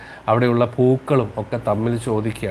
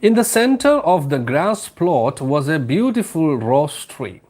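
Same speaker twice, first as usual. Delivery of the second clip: slow, lilting storybook read-aloud; slow story reading; 5 semitones higher, much slower than usual. Acted. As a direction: read it slowly, savouring each phrase. (പതുക്കെ കഥ വായന)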